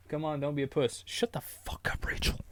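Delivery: hushed whisper. Real, not acted. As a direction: whisper it under the breath. Whispered aside.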